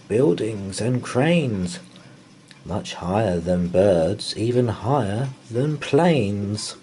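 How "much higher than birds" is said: usual speaking voice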